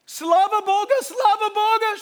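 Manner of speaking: high, loud tearful cry of praise; Russian words, cried out high and loud; crying babushka. Retold or imitated. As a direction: sob it out high and loud like an old woman. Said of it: "she's crying".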